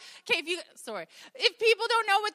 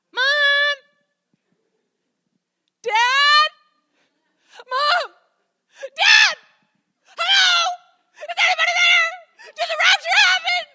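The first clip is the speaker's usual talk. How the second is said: much higher, louder calls for parents, laughing through; panicked shouting; 13 semitones higher, 14 dB louder, slower than usual. Retold or imitated. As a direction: yell for mom and dad in a night panic. Child calling out at night.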